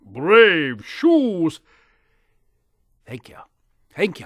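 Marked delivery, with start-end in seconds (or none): 0.0-1.6 loud, high call of the tree. Acted. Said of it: "and the tree said". 3.9-4.2 a bright, louder second thanks; louder thank you. Acted.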